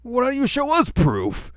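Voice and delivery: high, loud, slow indignant challenge; mock demand. Inuyasha demanding proof from the villagers, high and loud. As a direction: throw it back at them indignantly.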